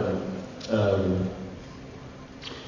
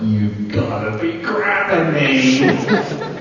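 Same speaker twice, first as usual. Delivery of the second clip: stunned delight; 5 semitones higher, 4 dB louder, much slower than usual. Retold or imitated. Offered Silver Surfer role.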